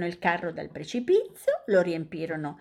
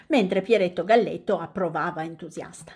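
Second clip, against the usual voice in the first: plain reading voice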